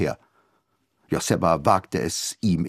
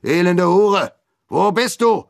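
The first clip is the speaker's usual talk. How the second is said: high, loud furious shout at his daughter; angry father yelling; 11 semitones higher, 6 dB louder than usual. A narrator's reading. Enraged father yelling at his runaway daughter.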